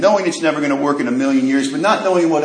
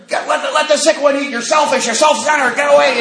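shooing the deer; 11 semitones higher than usual. Retold or imitated. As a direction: chase the healthy deer off, scolding. Wife shooing greedy deer.

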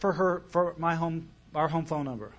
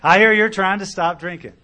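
retold line repeated louder and more forcefully; blunt repeat; 3 semitones higher, 8 dB louder than usual. Caller confronting a drinker with no small talk, blunt and louder. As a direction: punch it out loud, straight to the point. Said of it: "he wasted no time".

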